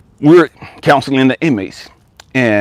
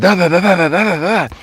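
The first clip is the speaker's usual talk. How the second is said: higher-pitched run of babbled syllables; mimicked outpouring; 7 semitones higher, faster than usual. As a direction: rattle on like floodgates opening. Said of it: "she's just opening up".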